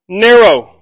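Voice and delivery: preacher stresses "Narrow" to warn the congregation, loud and falling; stressed narrow. Shown as a demonstration. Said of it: "Hard to get through".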